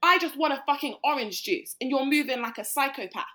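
angry rant at a man in the club; retold angry rant. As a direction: put him in his place. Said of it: "I was like".

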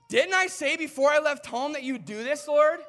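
loud, high, indignant sarcasm; sarcastic complaint. Retold or imitated. Jonah complains to God, sarcastic and loud. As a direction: throw it back at God as a pointed gripe. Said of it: "he almost gets sarcastic with God".

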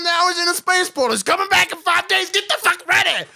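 high, frantic shouted rant; hammed-up raving messenger, high and urgent; crazy guy bursting in. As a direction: burst in yelling the whole backstory. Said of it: "If a crazy guy just burst the fuck in".

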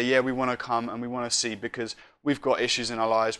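soft, earnest spoken prayer; closing prayer. Real, not acted. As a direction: pray aloud, quietening as it goes.

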